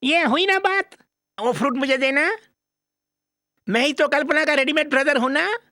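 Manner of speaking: put-on high-pitched overgrown child; childish comic son, eager. Comic son chatters about marriage.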